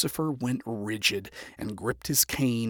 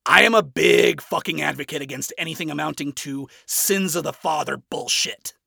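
plain reading voice

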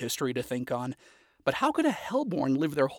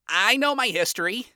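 higher, proud; proud young imp, higher and bright; proudly; 8 semitones higher, 4 dB louder than usual. A narrator's reading. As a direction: say it with pride. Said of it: "Moxie said proudly".

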